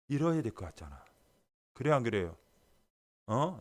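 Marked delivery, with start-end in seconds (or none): none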